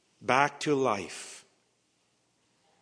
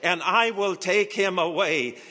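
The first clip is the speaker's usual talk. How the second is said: higher, louder plea; Mary pleading; 7 semitones higher, 6 dB louder than usual. Acted. Mary begging the gardener to give back the body, pleading. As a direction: beg the gardener. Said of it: "said to him".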